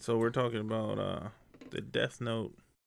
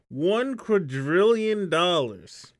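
dramatic announcement; 8 semitones higher, 9 dB louder, much slower than usual. Pretend. Announcing absurd price for book.

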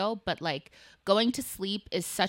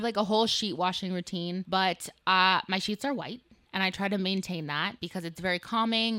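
normal talking voice

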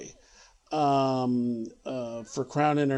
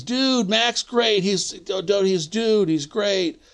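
higher, drawn-out laid-back dude voice, a mild send-up; hammed-up easygoing producer brushing off worries; imitating Charlie; 7 semitones higher, 4 dB louder, slower than usual. Narrator imitates Charlie dodging the cash question, higher dude voice.

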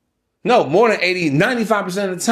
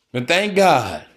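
ordinary talk, nothing marked

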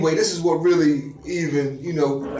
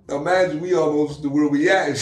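ordinary talk, nothing marked

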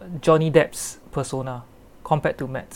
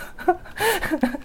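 high-pitched giggle; high laugh; 14 semitones higher than usual. Real, not acted. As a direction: burst out giggling. Laughing at own joke.

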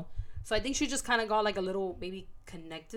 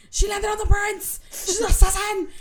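shrill-pitched, full shout of alarm; screaming onlookers; 12 semitones higher, 12 dB louder than usual. Retold or imitated. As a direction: shout it out high like a panicked crowd. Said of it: "she just hears fucking screaming".

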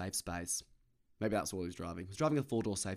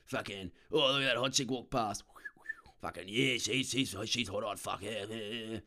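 put-on job-site bloke voice; blokey bloke. Comic mimicking a bloke ogling on a job site, crude blokey voice. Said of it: "being a blokey bloke".

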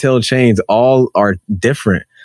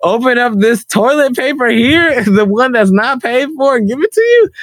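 incredulous worker pitched way up, laughing through it; high mock worker retort; 11 semitones higher than usual. Worker snapping back at customer over toilet paper, high incredulous voice. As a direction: toss it back like a fed-up employee.

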